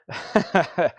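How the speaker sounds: a short breathless laugh. Real, not acted. Host laughing while reading out winners.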